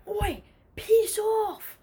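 high, loud angry shout; acting out a friend losing his temper; angry yell. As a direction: yell it to chase the boys off. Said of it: "he was getting angry".

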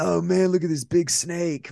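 high, amazed stoner voice. Retold or imitated. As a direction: marvel at the snake, stoned. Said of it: "why is that my go-to voice?".

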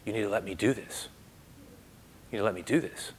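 soft, low reassuring words; calm him gently, quietly; gentle Jesus. Retold or imitated. Jesus reassuring the frightened blind man, soft and low. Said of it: "and I hear Jesus".

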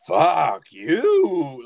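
imitating Idris Elba's weird accent on the line; mock odd accent. Imitating Idris Elba's accented curse at the kid in a film. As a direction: lean into his odd British accent, clipped. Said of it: "he just has this weird accent on it".

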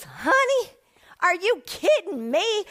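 high hammed-up sassy church lady; church woman's disbelief. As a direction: tease her for even asking. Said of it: "She goes".

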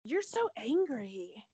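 slow, drawn-out "You're so angry"; mock Lisa Rinna, drawing out the accusation; a lightly voiced reality star accusing a castmate; Lisa Rinna impression. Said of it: "As Lisa Rinna would say".

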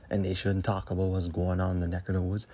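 normal talking voice

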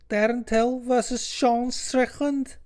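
put-on very high, slow Scouse accent; mock Scouse, stretched out and high. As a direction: put on an exaggerated squeaky Scouse voice. Name repeated in put-on Scouse accent.

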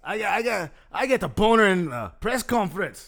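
loud, high mock Irish; Irish accent. Host still playing McGregor to cohost, loud mock Irish. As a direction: boast like a brash Irish fighter. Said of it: "should I be McGregor".

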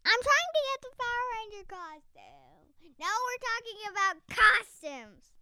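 excited. Real, not acted. Excited child.

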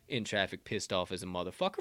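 normal talking voice

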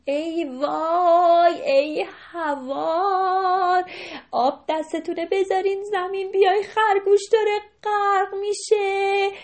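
high, drawn-out alarmed cry; frantic wail. Acted. Deer crying out to the storks that the rabbit is drowning. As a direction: wail for help in panic. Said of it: "شروع کرد به داد زدن".

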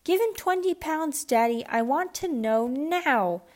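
high, drawn-out whiny demand; spoiled girl demanding. Acted. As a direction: nag Daddy until he gives in. Spoiled daughter demands from father.

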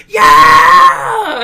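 a big whooping yell from a crowd of tweakers; mock tweaker shout. Retelling the concert crowd, a loud imitated tweaker yell. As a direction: whoop it out like a crowd cheering for meth. Tweakers cheering at the meth question.

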